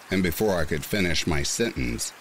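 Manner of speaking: ordinary delivery, nothing marked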